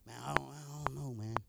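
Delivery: very low, hushed hesitant mumble; timid. Retold or imitated. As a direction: imitate timid reluctance, barely audible. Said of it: "they was timid".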